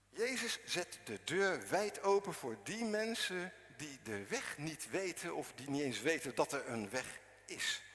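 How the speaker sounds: usual speaking voice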